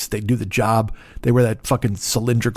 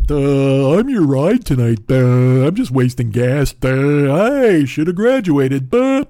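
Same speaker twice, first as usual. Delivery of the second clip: louder, slow dopey "duh" voice; drawn-out moron, duh after every line; dork voice; 4 dB louder, much slower than usual. Pretend. Uber driver mocks himself waiting outside, slow dopey dork voice. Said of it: "like a dork".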